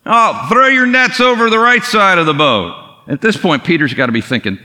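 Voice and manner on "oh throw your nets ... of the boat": high, louder called instruction; calling out. Retold or imitated. Jesus calling his advice to the boat, high and loud. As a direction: call the tip across the water.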